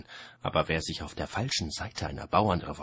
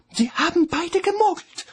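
much higher, loud outburst; blurted out; 12 dB louder than usual. A narrator's reading. Kelly blurts out in shock that both cheated, loud and high. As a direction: burst out in shock. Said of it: "entfuhr es Kelly".

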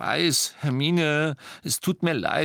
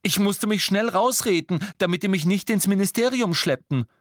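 high, urgent storytelling; worked-up, raised-pitch explanation; agitated account; 10 semitones higher, 4 dB louder than usual. A narrator's reading. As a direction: pour out the story in alarm. Ron tells of his capture.

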